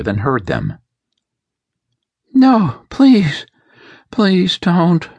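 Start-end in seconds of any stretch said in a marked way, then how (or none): 2.3-5.1 high, loud desperate plea; terrified pleading. A narrator's reading. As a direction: beg for your life. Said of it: "she whispered".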